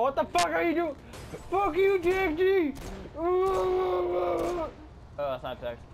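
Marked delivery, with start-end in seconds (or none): 0.0-1.0 high, loud imitated outrage; mock outraged quote. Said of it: "I'm going". 1.5-4.7 high, loud, slow yell; drawn-out yell. Real, not acted. Yelling at passenger.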